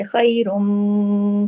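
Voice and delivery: खैरुम with the meem hummed long through the nose; long ghunna. Shown as a demonstration. Teacher showing students the full nasal meem. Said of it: "गुन्ना हो रहा है बड़ा".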